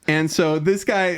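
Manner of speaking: ordinary talk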